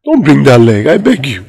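DONT pushed out loud and urgent. Acted.

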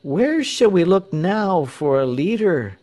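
high-pitched despairing question; crowd's lament. A narrator's reading. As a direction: ask it as a crowd left without hope. Dismayed crowd laments lost leader.

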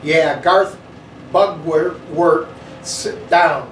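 a mild sneer on Bugwort; lightly voiced bully sneering down at him; big kid mildly sneering at Garth. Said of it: "the big kid sneered".

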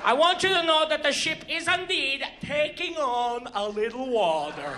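hammed-up Norwegian captain accent, slowing down. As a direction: do a singsong Scandinavian bandleader. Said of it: "[name] is driving the boat".

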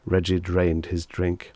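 ordinary delivery, nothing marked

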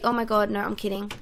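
ordinary talk, nothing marked

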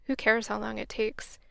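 ordinary talk

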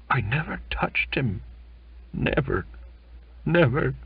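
sobbing. Acted. Belfast sobs his innocence to the narrator, mildly tearful. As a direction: plead through mild sobs. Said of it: "he sobbed".

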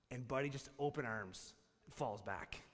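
ordinary talk, nothing marked

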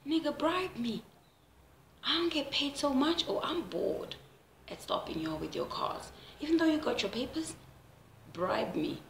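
lightly voiced officer asking for a bribe; traffic officer voice. Traffic officer asking a driver for a bribe, mock-pleading.

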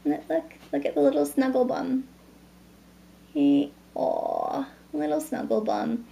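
dote on the little one; baby talk. Acted. Mother coos at baby.

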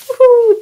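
high, loud joyful woohoo; shrieking party whoop; cheer. Real, not acted. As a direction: whoop it out in celebration. Celebrating New Year.

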